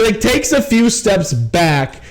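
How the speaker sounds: ordinary talk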